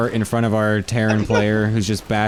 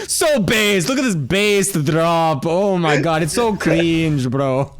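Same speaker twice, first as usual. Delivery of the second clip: hyped gamer bro; 5 semitones higher, slower than usual. Retold or imitated. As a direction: gush like an excited gamer bro. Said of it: "the S E two guys are like".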